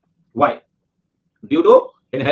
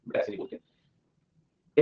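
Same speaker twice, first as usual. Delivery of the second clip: hushed scolding; 7 semitones lower, 10 dB quieter, much slower than usual. Acted. Gently scolding a joking student.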